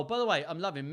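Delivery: ordinary delivery, nothing marked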